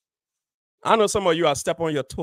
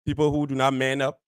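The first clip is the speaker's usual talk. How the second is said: ordinary delivery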